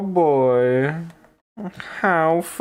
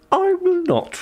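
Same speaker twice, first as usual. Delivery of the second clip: much higher, meek squeak; mock high reply; high squeaky reply. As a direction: squeak it obediently. Character answering meekly.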